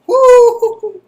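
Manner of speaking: high loud whoop; party whoop. Retold or imitated. Crashed a party.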